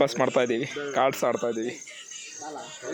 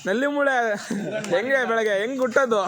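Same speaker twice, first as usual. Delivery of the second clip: teasing; 6 semitones higher than usual. Real, not acted. Teasing sleepy friend.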